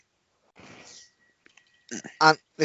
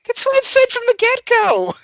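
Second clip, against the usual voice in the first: much higher, exasperated outburst; exasperated protest; 4 dB louder than usual. Real, not acted. Defending himself to friends over a racism charge, exasperated. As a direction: protest at a high pitch.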